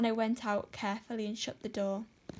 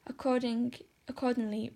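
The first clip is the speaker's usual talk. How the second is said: ordinary delivery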